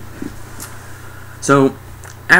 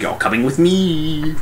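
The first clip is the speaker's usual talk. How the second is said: higher, drawn-out monster voice; grabbing alien; 6 semitones higher, slower than usual. Retold or imitated. Alien seizing a victim, mock menace. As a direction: stretch it out as a comic alien. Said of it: "grabs one of the dudes and all like".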